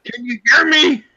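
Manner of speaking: loud joking check that he's heard. Real, not acted. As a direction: raise the voice as if to the hard of hearing. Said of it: "if I get louder".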